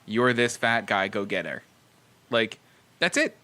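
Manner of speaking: ordinary talk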